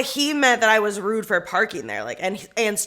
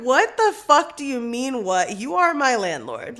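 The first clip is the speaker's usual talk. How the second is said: raised, louder acted-out outrage; indignant retort; 5 semitones higher, 5 dB louder than usual. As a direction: throw it back in disbelief. Retold angry retort to landlord.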